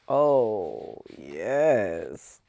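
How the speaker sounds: low, drawn-out relish; savoring. Real, not acted. Savoring the yarn.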